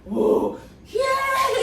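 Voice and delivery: loud whoa leaping to a very high yeah; a roaring audience; crowd cheer. Retold or imitated. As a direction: cheer like the room on its feet. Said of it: "and everybody stands".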